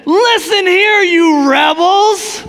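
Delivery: high, loud, slow furious address; angry. Retold or imitated. Enraged Moses rebukes the rebels, loud and slow. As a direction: spit out rebels in rage. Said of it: "it blows up in anger".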